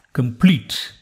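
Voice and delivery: ordinary delivery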